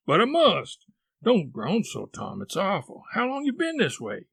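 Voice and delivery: high-pitched worried pleading; anxious, urgent brother; alarmed boy. Acted. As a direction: fret over a sick brother, pitch jumping. Sid alarmed by brother's groans.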